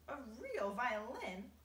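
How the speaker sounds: loud, high and drawn-out disbelief; indignant cry. A narrator's reading. Old grasshopper answering James's praise, loud astonished cry. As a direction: stretch the words out in affronted astonishment. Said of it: "the old green grasshopper cried".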